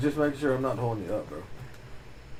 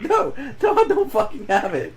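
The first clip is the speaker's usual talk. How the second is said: higher, louder, laughing through it; laughing refusal; 12 semitones higher, 5 dB louder than usual. Retold or imitated. Refusing his sister's request, laughing. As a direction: refuse while cracking up.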